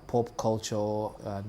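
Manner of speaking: normal talking voice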